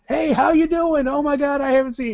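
high-pitched, gushing hello; imitated effusive greeting. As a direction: interrupt yourself to greet a long-lost friend, hammed-up. Sam derails story to greet someone.

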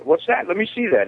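high, eager "let me see that"; excited retelling. Retold or imitated. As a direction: grab for it like a curious kid. Adam's excited voice in a retold story.

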